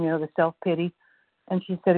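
usual speaking voice

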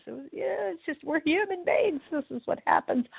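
high, soft, amused shrug in the voice; laughing at myself. Retold or imitated. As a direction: let it go lightly with a chuckle in the voice. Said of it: "I laugh at myself like I did this morning and go".

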